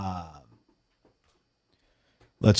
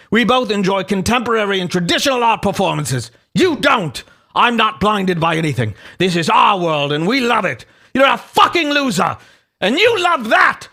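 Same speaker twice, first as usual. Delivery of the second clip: high, hammed-up indignant British rant; mock British tirade building to a shout; 6 semitones higher than usual. Host as Buckby defending their art world, outraged British voice. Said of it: "read Buckby's voice in a British voice".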